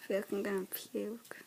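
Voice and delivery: queasy whisper; whisper, feeling sick. Real, not acted. Sick after the challenge.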